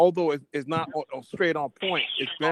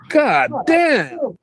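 exaggerated Southern drawl, high and loud; mock Southern accent; 9 semitones higher, 9 dB louder than usual. Mocking the actress's Southern accent, loud, high mock twang. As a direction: ham up an over-the-top Southern twang. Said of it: "That accent, it's like".

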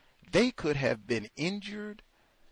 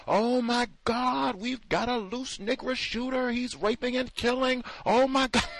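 mock panicked caller; 7 semitones higher than usual. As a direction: play a hammed-up frantic caller, pitched way up. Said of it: "was the same person who called nine one one".

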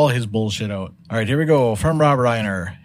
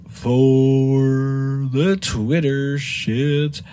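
drawn out sign-off; much slower than usual. Acted. Host closes segment drawn out.

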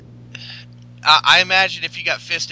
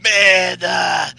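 loud, high, hammed-up meathead with forced laughs; Ryback; 4 semitones higher, 9 dB louder than usual. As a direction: shout it like a cocky wrestler, stretching the catchphrase. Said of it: "Ryback's like".

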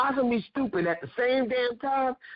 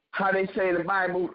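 ordinary talk

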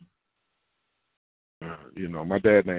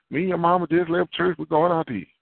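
lightly higher, breezy reply; parent answering casually; 7 semitones higher than usual. Retold or imitated. Parent telling the grown child, a hint of breezy. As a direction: toss it off like a carefree parent.

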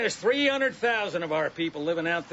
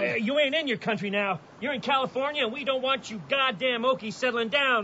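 higher pitched, scornful slur; contemptuous sneer; 6 semitones higher than usual. Acted. Deputy scorning the Okie mother, sneering. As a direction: spit out the insult with disdain.

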